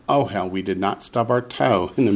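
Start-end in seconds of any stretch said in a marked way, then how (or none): 0.1-0.5 "ohel" stressed on its opening "o"; stress on first syllable. Shown as a demonstration. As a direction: lean on the first syllable of "ohel", let it fall. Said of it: "Accent on the first syllable".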